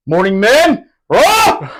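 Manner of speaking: loud, high, gung-ho morning shout; barking greeting. Retold or imitated. Staff sergeant greets the dishwasher men, loud gung-ho shout. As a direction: greet the delivery men like a drill instructor. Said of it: "That's how he answered".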